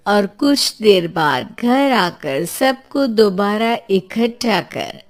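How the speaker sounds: plain reading voice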